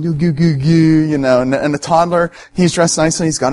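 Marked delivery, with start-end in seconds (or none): 0.0-1.1 lightly imitated baby talk; a touch of mock cooing; mom cooing at baby. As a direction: coo playfully, as a mom to her giggling baby. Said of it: "the mom's going".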